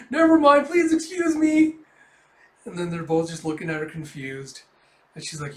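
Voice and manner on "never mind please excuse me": very high, loud frantic yelp; panicked. Acted. Yor fleeing the neighbours, panicked. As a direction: blurt it shrieking, then stretch the plea. She just panics and runs away.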